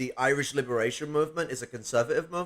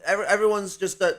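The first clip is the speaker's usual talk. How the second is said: ordinary delivery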